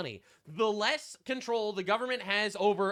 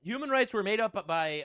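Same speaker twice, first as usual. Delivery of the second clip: normal talking voice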